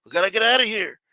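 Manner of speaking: hammed-up shut up, we gotta go; mock Bruce Willis. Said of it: "Bruce Willis is like".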